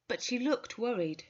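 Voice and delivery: ordinary delivery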